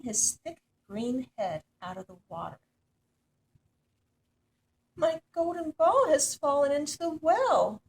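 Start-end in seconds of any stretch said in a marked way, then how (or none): 4.9-7.8 loud, high cry of distress. A narrator's reading. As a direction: call out in a panic about the lost ball. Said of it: "cried the princess".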